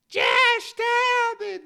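very high, loud, slow shriek; mock shrieking girlfriend. Mocking girlfriends who shriek during fights, very high shriek. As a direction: squeal it like a panicking girl. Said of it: "the ones that be like".

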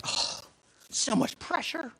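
high, drawn-out strained groan; anxious. Retold or imitated. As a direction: let the pressure squeeze your voice. Dreading offering prayer to stranger.

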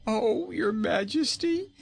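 high, loud, slow pleading king. A narrator's reading. Frightened king pleads with queen, high and loud. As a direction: beg for mercy. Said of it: "pleaded poor Rinkitink".

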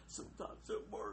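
breathy whispered echo, no voice. Shown as a demonstration. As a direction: whisper it back under your breath. Whispered echo of her line.

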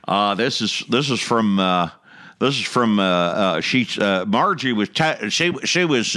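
lightly stammering Jimmy Stewart. Retold or imitated. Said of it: "I went and doing a Jimmy Stewart".

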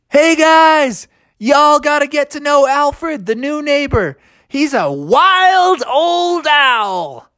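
high, exuberant holler; excited, high-pitched, stretching the ending; gleeful calling out. A narrator's reading. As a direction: shout down from the treetops. Bear calls happily from treetops.

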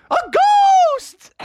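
high, drawn-out story shriek; mock alarm. Family sees dad in a sheet, alarmed. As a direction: cry out in pretend fright. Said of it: "And they're all like".

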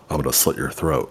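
low, soft mock threat; playful menacing undertone; murmured in the ear. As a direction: drop low and quiet, as if at someone's ear. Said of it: "sneak up behind their ear and say".

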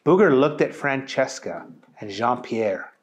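plain reading voice

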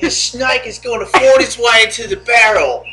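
hammed-up wildlife presenter, rising then settling; Steve Irwin. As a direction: narrate it like a wildlife documentary. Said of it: "I just imagine you steamer winning her".

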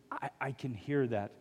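soft, low, halting echo of a tearful man; retold tearful words. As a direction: say it quietly, as if through tears. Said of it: "through the tears".

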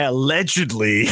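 "allegedly" picked out deliberately; careful stress on allegedly. Retold or imitated. Cellmate covering himself about the shotgun, stressed "allegedly". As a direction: set "allegedly" apart as a careful disclaimer. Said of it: "made sure to say allegedly".

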